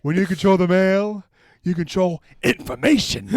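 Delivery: loud, high villainous declaration; Newman voice. Acted. Quoting Newman's line.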